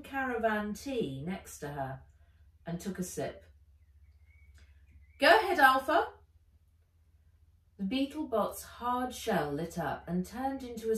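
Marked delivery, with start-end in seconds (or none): 5.2-6.1 loud, commanding baroness voice; a villain giving an order. Acted. Ivy ordering Beetlebot to report, loud.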